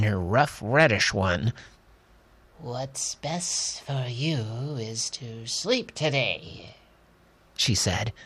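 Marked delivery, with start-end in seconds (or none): 2.6-6.8 soft, slow bedside comfort; gentle soothing voice. Acted. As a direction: soothe a sick old woman. Doctor comforts Granny.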